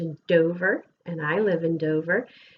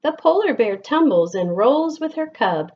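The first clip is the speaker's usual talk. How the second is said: lilting, sing-song picture book reading; gentle storytime voice with bouncing rhythm; reading to children; 4 semitones higher, 5 dB louder than usual. Acted. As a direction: read the rhymes aloud to a class of kids. Reads picture book to class.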